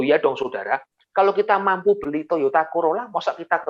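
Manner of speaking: normal talking voice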